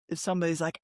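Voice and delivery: usual speaking voice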